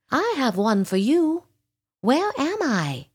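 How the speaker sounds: high-pitched indignant question; exasperated dragon. A narrator's reading. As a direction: turn the question back on them, pitched high. Dragon turns question on owl.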